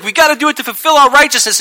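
fast, high, loud insistence; fighting yell. Retold or imitated. Jesus insisting to John mid-fight, yelling. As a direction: yell it urgently in an argument. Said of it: "I just told you they were fighting".

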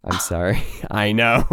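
ordinary talk, nothing marked

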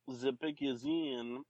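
slow, stretched announcer's delivery; drawn-out announcement. Shown as a demonstration. Announcing the draft pick after an interruption, slow and drawn out. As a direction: draw out every word of the announcement.

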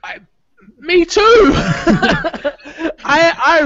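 high, loud burst of laughing excitement; delighted outburst breaking into laughs; laughing agreement. Real, not acted. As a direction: blurt it out loudly through laughter.

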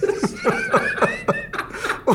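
high, breathy burst of laughter; real laugh. Real, not acted. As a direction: crack up at the punchline. Host laughs at story.

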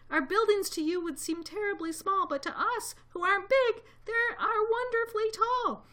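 very high, piping little voice; tiny mayor calling. A narrator's reading. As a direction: proudly call up as a tiny mayor. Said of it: "called the voice".

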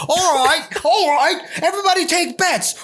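high, hammed-up kangaroo ringmaster calling for bets; talking kangaroo. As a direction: pitch it way up like a cartoon kangaroo running the fight. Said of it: "does your kangaroo talk".

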